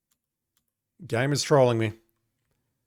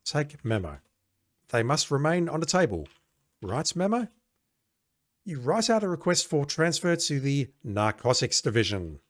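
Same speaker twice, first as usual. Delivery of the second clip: ordinary talk, nothing marked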